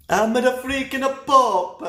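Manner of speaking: high, loud, slow, laughing; hammed-up pope brag; mock Italian priest. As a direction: boast like a cartoon Italian priest.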